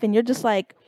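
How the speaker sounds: ordinary delivery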